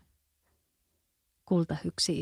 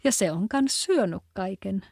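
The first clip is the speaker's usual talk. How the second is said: plain reading voice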